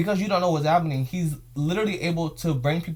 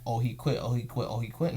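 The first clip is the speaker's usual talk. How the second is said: mocking gossip; 4 semitones lower, 6 dB quieter than usual. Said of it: "that's the story that's gonna get around".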